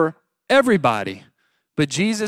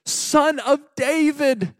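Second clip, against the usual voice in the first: high, pleading mock scream; 8 semitones higher than usual. Blind man begging Jesus for attention, mock screaming. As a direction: call out high and desperate, stretching the first names. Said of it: "started just screaming".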